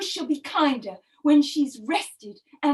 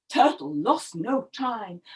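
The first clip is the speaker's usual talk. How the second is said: ordinary delivery, nothing marked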